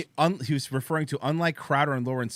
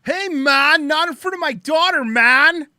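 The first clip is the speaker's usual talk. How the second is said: loud, high-pitched mocking yell; mock shouting father; 11 semitones higher, 11 dB louder, slower than usual. Host mimics angry dad from the clip, mock shouting.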